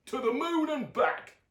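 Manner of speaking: Dad reassures Luna, high fond voice; loving Dad. A narrator's reading. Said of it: "said Dad".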